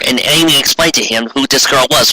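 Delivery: plain reading voice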